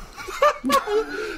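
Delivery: helpless falsetto laughter; high shrieking laugh. Real, not acted. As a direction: squeal with laughter. Laughing at dull marriage.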